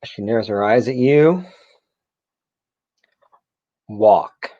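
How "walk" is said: curt, loud order, voice falling; angry command. Acted. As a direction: bark a one-word order. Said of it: "an angry woman telling you".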